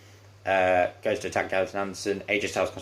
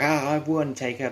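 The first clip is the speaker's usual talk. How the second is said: higher, mock-triumphant crowing; hammed-up victory boast, pitched up; retold gloating; 7 semitones higher than usual. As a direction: act out a wrestler gloating over a win. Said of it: "starts laughing to himself".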